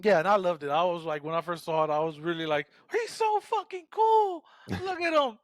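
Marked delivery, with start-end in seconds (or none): 2.9-5.4 high, slow, starstruck gush; mock fangirl squeal; gushing fan. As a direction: swoon over how cool he is.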